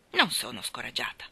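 a short, dry denial; curt reply. Acted. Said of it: "fu la secca risposta".

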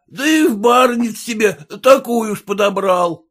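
high-pitched, indignant cursing; loud-mouthed abuse; scolding peasant. A narrator's reading. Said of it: "Краснорожий мужик заругался".

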